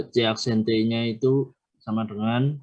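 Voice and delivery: normal talking voice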